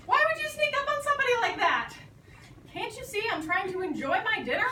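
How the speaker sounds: high, loud, fast cranky critter; indignant platypus. Acted. The platypus scolding George, high and indignant. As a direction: scold him for interrupting dinner.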